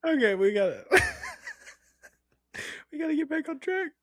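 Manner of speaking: laughing. Real, not acted. Laughing through the words.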